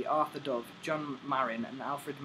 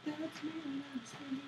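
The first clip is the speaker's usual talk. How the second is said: pitched up, teasing echo; high mocking echo; 13 semitones higher, 6 dB quieter than usual. Friend mimicking her line in a high voice. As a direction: repeat it high and sing-song.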